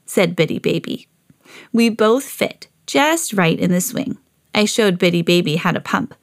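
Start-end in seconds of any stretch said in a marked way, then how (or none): none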